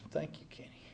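hushed thanks. Retold or imitated. Veteran finally thanking Kenny for his sacrifice, whispered. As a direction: breathe the thanks almost silently.

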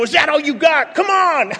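defiant taunt. Retold or imitated. Voicing defiant drunks.

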